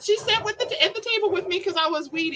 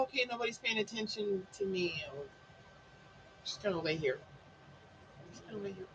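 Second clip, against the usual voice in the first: low, soft mock resignation, fading away; deflated dog; 7 semitones lower, 17 dB quieter than usual. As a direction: voice a dog giving up and flopping down. Voices the dog giving up.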